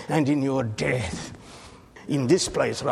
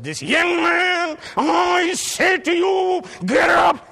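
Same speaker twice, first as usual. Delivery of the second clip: loud, high commanding shout; Jesus commanding; 8 dB louder than usual. Acted. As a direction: command the dead man to rise. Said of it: "And he said".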